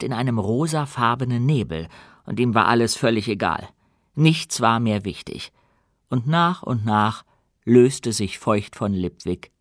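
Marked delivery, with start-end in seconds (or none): none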